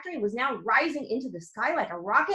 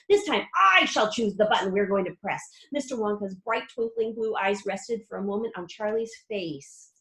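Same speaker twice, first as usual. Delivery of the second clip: ordinary delivery, nothing marked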